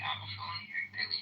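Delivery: whispered. Shown as a demonstration. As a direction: drop to a breathy whisper. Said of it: "I just wanna hear it clearly".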